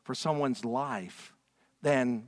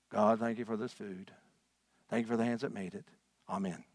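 rote prayer; 5 semitones lower, 4 dB quieter than usual. Retold or imitated. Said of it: "A prescription prayer has no emotion to it".

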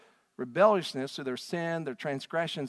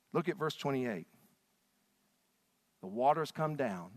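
ordinary delivery

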